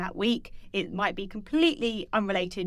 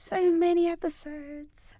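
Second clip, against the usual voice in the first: drawn-out high echo; 8 semitones higher, slower than usual. Acted. Echoing co-host, drawn out.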